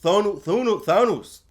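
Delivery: "Thanos" repeated as "THOH-noos"; fumbling imitation of "Thanos" with oo ending; mispronounced Thanos. Not doing a good impression, "Thanos" with a wrong oo. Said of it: "I'm not doing a good impression".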